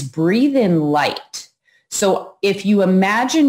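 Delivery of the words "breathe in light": long, unhurried words; slow meditation guidance; slow guided cue. Shown as a demonstration. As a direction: draw out "breathe in light" like an inhale. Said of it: "close your eyes".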